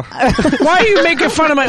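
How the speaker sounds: laughing protest; real laughter while objecting. Real, not acted. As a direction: laugh through the words.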